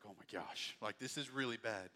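low, hushed acted dismay; quietly stunned; subdued dread. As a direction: drop to a soft, sinking voice. Passenger hears he may crash.

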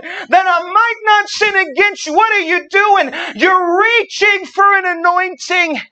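high, loud preacher climax; preaching at full force. Acted. Preacher crying out a prayer to the church, high and loud. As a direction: shout the prayer up to heaven.

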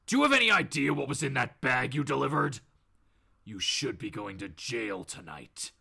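low menace, dropping very deep; Batman. Acted. The vigilante leaning over Bob, scolding in a low voice. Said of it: "leans in closer to Bob here and goes".

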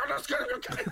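very high, squeaky mock repeat; mocking echo. Man mocking his girlfriend's reassurance, high mocking echo. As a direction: parrot her reassurance back in a high voice. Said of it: "And I'm like".

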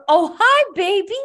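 high, sweet greeting to a dog. Real, not acted. Greeting her friend's dog as it appears, cooing. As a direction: coo at the puppy.

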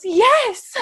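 high loud gleeful yes; excited yes. Retold or imitated. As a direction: shout it, thrilled. Mary excited.

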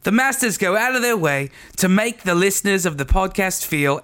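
put-on English accent. Taking a turn at the listener's review, English accent. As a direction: read on in a mock English accent. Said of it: "read this with an English accent".

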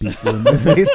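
laughing quote, loud. Retold or imitated. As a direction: say it through laughter. Quoting scene, laughing.

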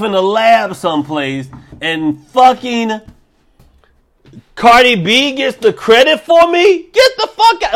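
the virus, outraged about losing the credit; offended coronavirus. Pretend. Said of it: "I'm talking like coronavirus".

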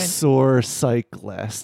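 "Exorcyclist" stretched out slowly for a learner; slow pronunciation. Shown as a demonstration. Coining a word for a friend who needs to learn it, slowly pronounced. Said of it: "I need you to pronounce it slower".

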